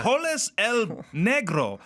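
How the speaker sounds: slow, drawn-out mock Spanish accent. Shown as a demonstration. Co-host joking about Chipotle orders, slow Spanish accent. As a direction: draw out each word with a put-on Spanish accent. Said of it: "Is it racist to do the accent?".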